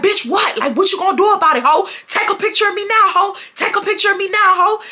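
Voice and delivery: going off. Retold or imitated. Student going off on a classmate in her face, taunting. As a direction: get in her face and dare her. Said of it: "going off on her".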